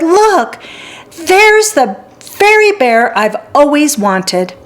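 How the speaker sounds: put-on excited little girl. Girl pleads for the bear.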